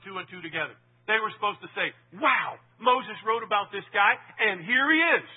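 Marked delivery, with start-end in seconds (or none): 2.2-5.3 louder, slower wow of discovery; a touch of excited surprise; imagined excitement. Retold or imitated. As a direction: play the sudden realisation. Imagined Pharisees realising the truth.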